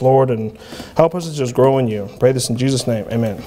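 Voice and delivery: low, hushed pastor's prayer; closing prayer. Acted. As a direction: pray over the congregation humbly.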